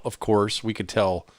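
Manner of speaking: ordinary delivery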